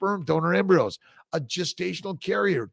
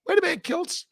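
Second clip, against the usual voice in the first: much higher prim scolding voice; mock self-scolding voice; 14 semitones higher, faster than usual. Host scolding himself for swearing, mock high voice. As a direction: play your own scold, high and prissy.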